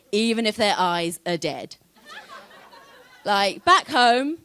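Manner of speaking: ordinary talk, nothing marked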